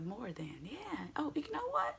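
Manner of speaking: soft then squeaky high; mock realization. Speaker retelling her realization, hushed then high. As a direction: whisper it, then pop up in surprise. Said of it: "And I was like".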